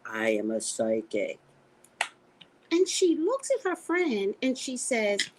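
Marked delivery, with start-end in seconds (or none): 0.1-1.4 low, portentous self-quote; retold solemn announcement; hovering pronouncement. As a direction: let the words hang in the air, low. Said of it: "let it hover over us".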